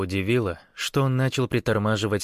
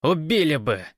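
scolding; 7 semitones higher, 4 dB louder than usual. A narrator's reading. Guard scolds over loudspeaker.

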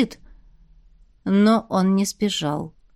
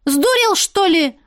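much higher indignant shout; angry bark; 8 semitones higher, 4 dB louder, slower than usual. A narrator's reading. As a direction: bark it at him furiously. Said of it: "рявкнула она".

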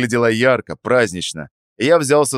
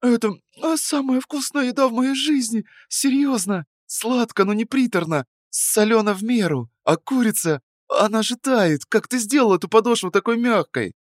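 put-on high, enthusiastic young woman. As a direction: gush with delight. Narrator voices Sveta.

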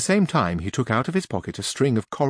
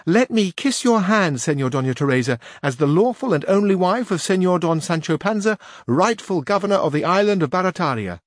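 page; 8 semitones higher than usual. A narrator's reading. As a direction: voice the page lifted high.

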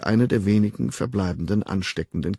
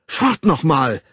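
a sharp, louder rebuke, mildly acted; snapping at him; 5 semitones higher, 6 dB louder, slower than usual. A narrator's reading. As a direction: brush him off with a curt snap. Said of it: "herrschte Lenina ihn an".

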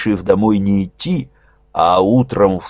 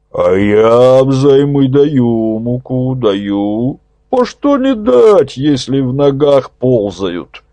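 put-on slow, cracked old man's voice; a creaky old elder, unbothered; 5 semitones higher, 4 dB louder, slower than usual. A narrator's reading. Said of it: "Раздался надтреснутый голос".

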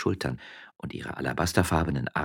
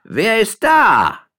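high, loud, slow call from a window; 13 dB louder, much slower than usual. Acted. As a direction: shout it down from upstairs. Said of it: "eine weibliche Stimme rief herunter". (laut von oben herabrufend)

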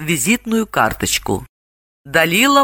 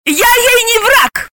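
very high, appalled exclamation; aghast, drawn-out high protest; horrified; 4 dB louder, slower than usual. A narrator's reading. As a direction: recoil at the very idea. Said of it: "ужаснулась Галина".

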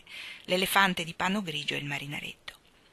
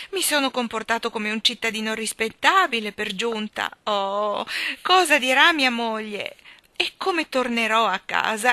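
high, wailing lament; distressed moaning; 4 semitones higher, 6 dB louder than usual. A narrator's reading. Postman fretting about his wife, distressed wail. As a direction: lament it, growing louder and more upset.

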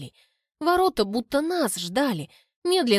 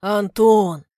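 louder father's bass greeting, lightly voiced; booming father; 8 dB louder than usual. Father greets daughter's boyfriend, lightly voiced bass boom. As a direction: boom out the name. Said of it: "басит отец".

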